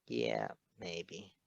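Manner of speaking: low, soft, shrugging maybe; quiet evasive reply; noncommittal. Acted. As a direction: brush it off without committing. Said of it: "replied noncommittally".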